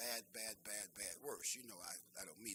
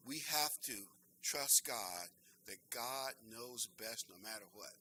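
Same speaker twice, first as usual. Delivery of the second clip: ordinary talk, nothing marked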